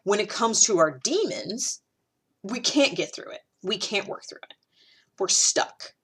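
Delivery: ordinary delivery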